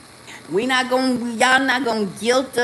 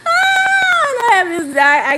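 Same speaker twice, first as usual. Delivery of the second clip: laughing shout; 13 semitones higher, 6 dB louder than usual. Real, not acted. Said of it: "laughing outburst".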